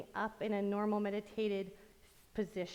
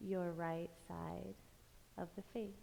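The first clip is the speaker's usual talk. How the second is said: slow, soft meditation voice with long pauses; guided meditation; 6 dB quieter, slower than usual. Acted. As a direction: lull them through a body scan, phrase by unhurried phrase. Leads guided body-scan meditation.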